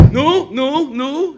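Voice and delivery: mock outraged yell, each word hurled out; shouted objection. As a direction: imitate a high, loud cry of protest. Man objecting to every noise.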